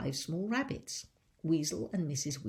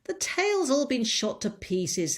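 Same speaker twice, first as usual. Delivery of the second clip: louder, higher lady of the house; voice from upstairs; 7 semitones higher, 8 dB louder than usual. Acted. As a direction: answer down from upstairs, a bit louder. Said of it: "said the voice from upstairs".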